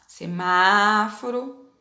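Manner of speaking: "semáforo" very slowly, dwelling on an open MÁ; open stressed A. Shown as a demonstration. Teacher repeating the word for pupils, open stressed A. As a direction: hold the open Á of "semáforo" long. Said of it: "Lê em voz alta".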